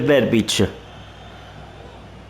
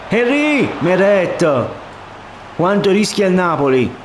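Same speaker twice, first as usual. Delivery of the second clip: higher, urgent play-by-play; sudden alarm at a chance; excited commentary; 6 semitones higher, slower than usual. Real, not acted. Danger near goal.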